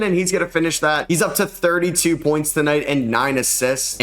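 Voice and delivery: ordinary delivery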